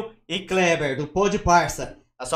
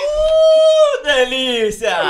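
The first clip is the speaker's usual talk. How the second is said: excited whoop; 8 dB louder, much slower than usual. Real, not acted. Cheering the mother's joke.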